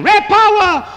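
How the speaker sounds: high, full-voiced rallying yell with a whoop; yelling war cry.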